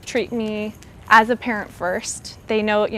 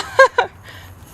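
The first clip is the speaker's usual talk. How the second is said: a high, teary burst of laughter; real laughter through tears; tearful laugh; 14 semitones higher, 7 dB louder than usual. Real, not acted. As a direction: laugh that is half a sob. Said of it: "I'm getting emotional".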